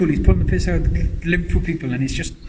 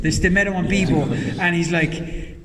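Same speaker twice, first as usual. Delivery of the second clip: ordinary talk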